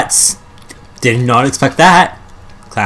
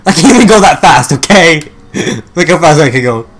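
loud, higher, frantic complaint; being chased; 5 semitones higher, 9 dB louder than usual. Real, not acted. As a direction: yell it while fleeing. Running from pursuer in game.

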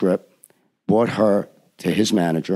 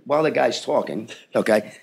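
ordinary delivery

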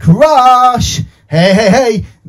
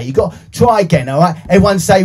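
ordinary delivery

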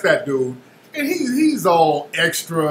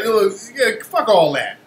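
normal talking voice

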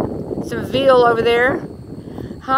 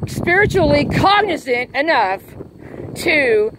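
fire and brimstone; 5 semitones higher, slower than usual. Real, not acted. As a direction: hammer each word like a preacher. Preaching doom at Q followers.

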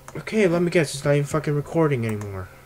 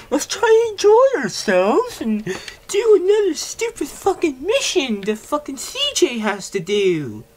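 shrill, much higher angry outburst; angry rant; 14 semitones higher, 5 dB louder than usual. Acted. Gamer raging at the mission in a shrill, high angry voice. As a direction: rant at the game in a high, loud squeal. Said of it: "Sorry that I'm angry".

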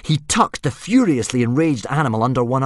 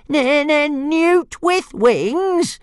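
very high, drawn-out indignant squeal; stammer, then stretch it into a squeal; outraged little dragon; 4 dB louder, much slower than usual. A narrator's reading. Dragon outraged at repeated insult.

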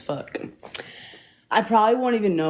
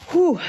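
high loud exhale; sharp exhale; 13 semitones higher, 6 dB louder than usual. Real, not acted. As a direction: blow out hard to recover. Catching breath after asthma.

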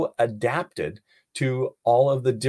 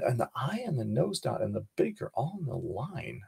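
soft murmur, noticing details to herself; quiet thinking aloud; 5 dB quieter than usual. Shown as a demonstration. Teacher modeling quiet noticing aloud while students talk over her. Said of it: "Everybody talk at the same time wherever you are".

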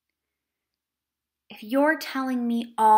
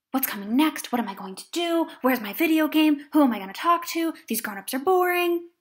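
put-on anxious child thoughts; 5 semitones higher, 6 dB louder, faster than usual. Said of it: "those anxieties".

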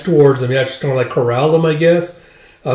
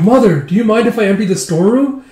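high, louder, animated asking; eager request; 8 semitones higher, 5 dB louder than usual. Acted. Daughter-in-law rushing in to ask mother, eager request. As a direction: pitch it up, rushing to ask a favour.

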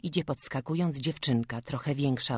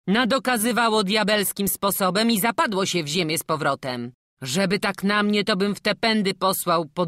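loud, higher-pitched taunting; triumphant villager; 5 semitones higher, 8 dB louder than usual. Acted. Superstitious villager crows that the child was a devil, loud taunting. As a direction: challenge the doubters loudly. Acted villager crowing over doubters.

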